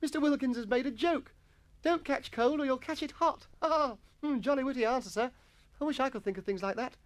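high boy voice, exaggerated admiration; delighted schoolboy. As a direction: gush over the master's joke. Jennings praises master's joke.

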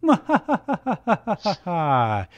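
high, descending staccato cackle; acted evil laugh; villainous laugh. A narrator's reading. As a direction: cackle in a falling run of ha-has. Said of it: "She laughs".

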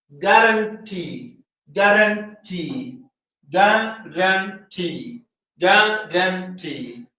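ഗ്യാരണ്ടി slowly divided, with a long final TEE; syllable by syllable. Shown as a demonstration. Teacher counting out ഗ്യാരണ്ടി, syllable by syllable. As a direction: split ഗ്യാരണ്ടി into ga, ran, tee. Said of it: "One, two, three".

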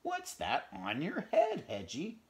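lightly voiced hen teasing. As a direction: tease like a mother hen.

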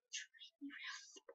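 breathy whispered repeat. Real, not acted. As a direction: whisper the line to herself.

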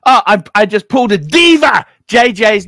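shouting the news out loud; loud boast. Real, not acted. Host boasting to co-host, shouted. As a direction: announce it proudly at full volume. Announcing his diva move.

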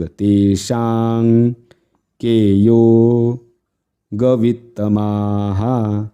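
तेषां drawn out long into the nasal; long vowels. Shown as a demonstration. Teacher explains anusvara length, stretching तेषां. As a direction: hold षां in तेषां, chanting very slowly. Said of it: "दीर्घ".

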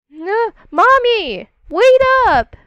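high, loud cry of no, mommy, wait up; calling after mommy. Pretend. Child shouting after mommy who walks away, high and loud. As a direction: yell after her so she stops, pitch way up.

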